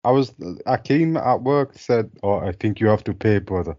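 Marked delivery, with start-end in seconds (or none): none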